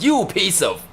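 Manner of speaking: high, sputtering fake anger at his son; lightly hammed-up angry father; mock angry dad. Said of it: "he was like".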